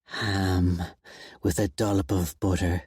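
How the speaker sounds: ordinary delivery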